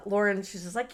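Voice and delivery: usual speaking voice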